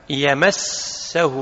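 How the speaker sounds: "يمسه" with an a-vowel on the م, drawn out slowly; fatha op de miem. Shown as a demonstration. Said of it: "بفتح الميم".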